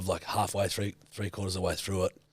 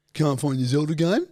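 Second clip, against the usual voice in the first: high, louder mocking kid voice; smug little brother taunt, pitched up; 7 semitones higher, 5 dB louder than usual. Little brother taunting him over the deleted game, high and smug. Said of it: "And he's like".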